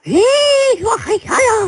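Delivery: very high, loud witch chant. Shown as a demonstration. Host performs witch on co-host's request, high screechy chant. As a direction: shriek a spell like a witch. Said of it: "Yung parang mangkukulam na boses talaga".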